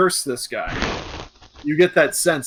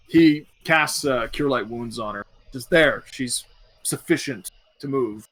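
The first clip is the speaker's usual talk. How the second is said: normal talking voice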